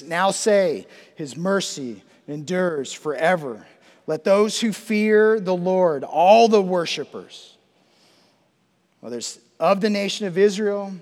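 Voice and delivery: rally every group of worshipers, punching the key words; forceful psalm preaching. Real, not acted. Preacher summons Israel, priests and Gentiles to respond, forceful proclamation.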